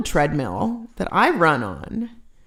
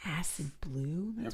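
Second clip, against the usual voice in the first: puzzled whisper; 3 semitones lower, 17 dB quieter than usual. Real, not acted.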